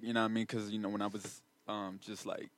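low, soft imitation of a rapper; mock rapper talk. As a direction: mimic a rapper mumbling through an interview. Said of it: "They're like".

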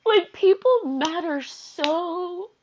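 high, drawn-out, tearful words; starting to cry. Real, not acted. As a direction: push slowly through rising tears. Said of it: "I'm about to start crying".

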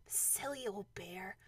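slow, whispered fond aside; very soft, affectionate murmur; said to oneself. A narrator's reading. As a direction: whisper it privately, fondly. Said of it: "you didn't say it out loud".

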